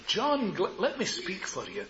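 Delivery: ordinary talk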